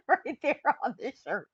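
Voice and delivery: giggling at her own shirt; laughing. Real, not acted. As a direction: laugh through the words with delight. Delighted by her funny shirt.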